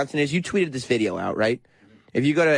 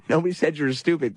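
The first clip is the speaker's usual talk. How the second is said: ordinary talk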